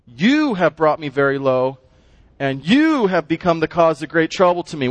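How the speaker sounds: accusing stress on "you", the rest falling away. Acted. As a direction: hit "you" hard at the start of each clause. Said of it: "that word "you" is being emphasized".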